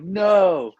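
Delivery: high, loud hammed-up no; mock yell. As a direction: yell it like a villain in a panic. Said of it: "Sideshow Bob's like".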